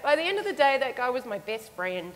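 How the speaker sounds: ordinary talk, nothing marked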